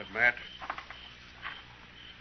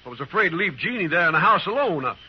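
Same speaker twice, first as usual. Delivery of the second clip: higher and louder, frantic protest; desperate denial; 7 semitones higher, 5 dB louder than usual. Acted. Bert begs the marshal to believe him, desperately. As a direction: plead innocence as the voice climbs. Accused friend protests his innocence.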